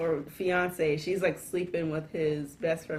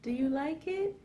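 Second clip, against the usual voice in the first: high, soft girlish imitation; hammed-up coy girlfriend voice; movie girlfriend; 11 semitones higher, 4 dB quieter than usual. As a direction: play the girlfriend, much higher and lightly. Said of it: "and she's like".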